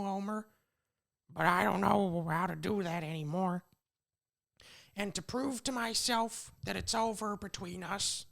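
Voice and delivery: high, far-off Marge voice, sad goodbye; Marge Simpson impression. Podcaster doing Marge leaving Homer, high impression. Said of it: "I'm gonna do my best Marge impression".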